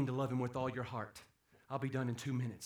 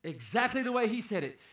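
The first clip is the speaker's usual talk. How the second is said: usual speaking voice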